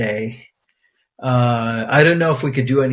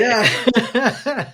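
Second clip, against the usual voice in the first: laughing; 9 semitones higher than usual. Real, not acted. Laughing at the pirate joke.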